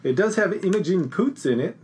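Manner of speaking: chuckling through the words; laughing. Real, not acted. Laughing while speaking.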